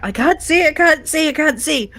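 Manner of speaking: loud, high panicked cry, repeated; high-pitched acted scream. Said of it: "and screaming out".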